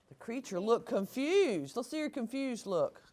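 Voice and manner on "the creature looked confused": ordinary delivery, nothing marked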